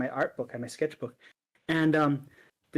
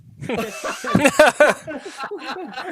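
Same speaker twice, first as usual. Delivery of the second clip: big real laugh bursting out, higher and louder; hearty laugh; 9 semitones higher, 8 dB louder than usual. Real, not acted. Laughing out loud at the end of his own fight story.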